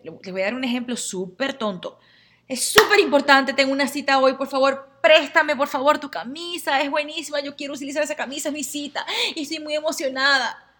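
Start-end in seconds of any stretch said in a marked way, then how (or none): 2.5-3.5 súper importante said higher and louder, eager; excited pleading. Acted. Friend begging to borrow a shirt, louder and excited. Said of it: "Y tú me dices". 3.5-10.7 excited pleading. Acted. Best friend begs to borrow a shirt for her date, excited. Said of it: "Y tú me dices".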